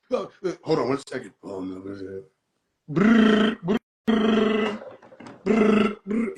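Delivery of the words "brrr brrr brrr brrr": imitated phone ringing, buzzing lips. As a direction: make the ringing sound of a phone.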